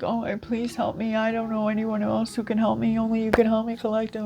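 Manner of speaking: high, pleading whiny plea; a put-on needy voice asking for help; mock sob story. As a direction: ham up a helpless begging ex. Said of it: "use a sob story too".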